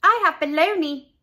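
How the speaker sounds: lightly voiced girl. A narrator's reading. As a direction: announce her lunch. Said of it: "said Leslie".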